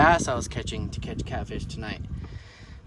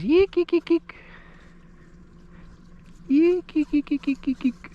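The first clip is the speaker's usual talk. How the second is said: fish call; 14 semitones higher than usual. Real, not acted. Calling fish again.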